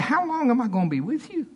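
high-pitched, exasperated complaint; not happy. Retold or imitated. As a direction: retell it as a fed-up teacher. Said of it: "he was not happy".

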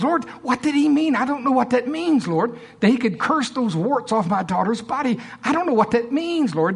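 high, pleading voice of a puzzled farmer praying; Norval imitation. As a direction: beg the Lord, pitched well up, bewildered. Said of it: "do my Norval imitation".

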